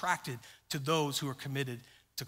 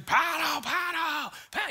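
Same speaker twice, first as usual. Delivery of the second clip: high-pitched screamed command; mock screaming; 11 semitones higher, 3 dB louder than usual. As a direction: imitate a frantic raft guide yelling orders. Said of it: "and he starts screaming".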